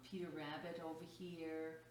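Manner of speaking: usual speaking voice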